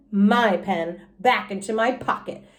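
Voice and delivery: usual speaking voice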